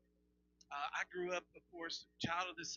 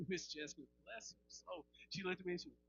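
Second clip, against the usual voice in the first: ordinary talk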